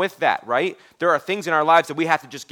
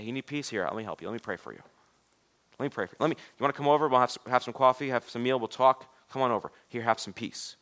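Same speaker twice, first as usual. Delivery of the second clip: soft, tender voice, much quieter; gentle offer; 3 semitones lower, 6 dB quieter than usual. Acted. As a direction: offer peace gently, like to a hurting friend. Offering peace to a stranger.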